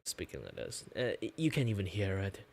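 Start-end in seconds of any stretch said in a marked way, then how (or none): 0.0-0.8 deep, lazy, relaxed register; relaxed low voice. Shown as a demonstration. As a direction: drop much lower, easy and unforced. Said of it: "It's much more relaxed too".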